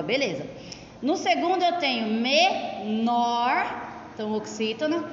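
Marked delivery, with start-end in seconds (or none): 2.2-3.7 "menor" in two slow, separated syllables, me, nor; syllable split. Shown as a demonstration. Teacher showing the class an oxytone, "menor" split slowly.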